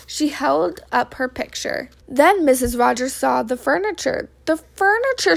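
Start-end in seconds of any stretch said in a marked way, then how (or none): 4.4-5.4 high-pitched cry of alarm; dismayed cry. A narrator's reading. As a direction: cry out at the sight, pitch jumping up. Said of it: "she cried".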